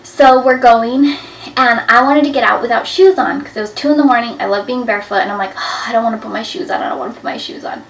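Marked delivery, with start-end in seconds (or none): 5.6-7.8 whiny refusal. Retold or imitated. Whining to husband about shoes.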